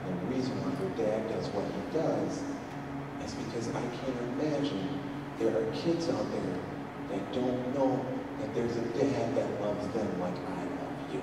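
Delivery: very soft, low fatherly voice; quiet, heartfelt retelling; tender reassurance. Retold or imitated. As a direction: reassure a hurt child, hushed. Dad reassuring daughter of love.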